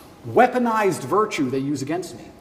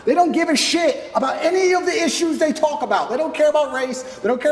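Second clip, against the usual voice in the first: heated shout; 9 semitones higher, 3 dB louder than usual. Real, not acted. Angry rant about critics.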